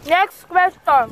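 slow game-show announcing; slow drawn-out announcement. Acted. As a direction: stretch each word out slowly. Host announces the next card dramatically.

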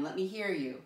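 ordinary talk

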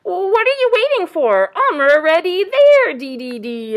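high, loud, impatient little bird; exclaiming chickadee. A narrator's reading. Chickadee calls waiting animals over, exclaiming high. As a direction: exclaim it, chirpy and urgent. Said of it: "exclaimed the chickadee dee".

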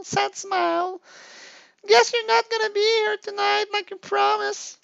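put-on high, whiny, needy girlfriend voice; clingy girlfriend. Girlfriend reading her needy messages aloud in a high whine. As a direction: plead like a lonely girlfriend in a high voice.

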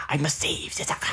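breathy whispered line. Acted. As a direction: whisper it with a sigh. Voicing game character line.

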